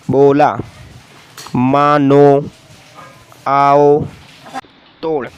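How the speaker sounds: teacher reading words for the class to repeat; word drill. Shown as a demonstration. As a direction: call out each word clearly. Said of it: "मुफरदात".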